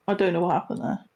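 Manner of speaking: ordinary delivery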